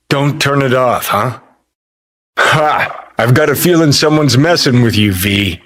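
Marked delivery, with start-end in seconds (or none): 2.4-3.1 short, higher "ha"; single scoffing laugh; amused laugh. Acted. Sensing someone's messing with V, an amused laugh.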